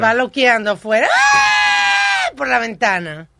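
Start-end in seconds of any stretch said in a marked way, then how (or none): 1.2-2.3 scream. Retold or imitated. Acting tourist's scream.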